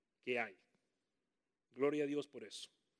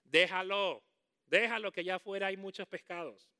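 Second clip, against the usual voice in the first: lightly voiced, louder pushy adviser; know-it-all adviser; 2 semitones higher, 7 dB louder than usual. Preacher mocking someone who gives unasked advice, pushy and loud. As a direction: play a meddler handing out advice. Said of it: "nada de que vienen y".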